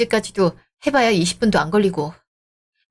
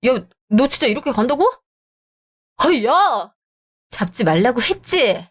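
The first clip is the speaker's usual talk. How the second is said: ordinary delivery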